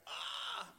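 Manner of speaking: high startled cry; a sudden high yelp of shock; mock gasp. Mother hit by spilled tea, startled high yelp.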